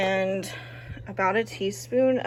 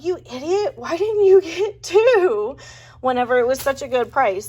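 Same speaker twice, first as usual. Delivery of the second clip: higher, louder mock scold at herself; mock self-scolding; 6 semitones higher, 7 dB louder than usual. Vlogger scolding herself for not buying two roasts, hammed-up self-scold. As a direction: tease yourself for a missed bargain. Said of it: "And I thought".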